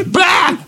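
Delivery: jump scare shout. Retold or imitated. Jump scare prank imitation.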